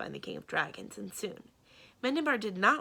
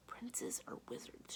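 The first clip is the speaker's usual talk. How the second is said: slow, much softer whispered mutter; 4 semitones higher, 13 dB quieter, slower than usual. A narrator's reading. Samarine guesses who is outside, whispered mutter. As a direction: mutter it to herself. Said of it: "she muttered".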